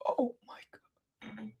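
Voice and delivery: breathless whispered oh my God; barely voiced, fighting tears; hushed whisper. Real, not acted. Whispering while forcing tears for the camera. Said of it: "The tears are coming".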